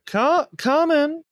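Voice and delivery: high, slow call from inside; woman's voice. Acted. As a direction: call out to the door like a homely hostess. GM voices woman answering door.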